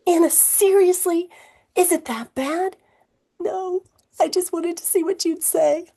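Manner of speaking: put-on high-pitched teen girl, teasing; young girl. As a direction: play the cheeky little girl. Girl Anna voiced in story.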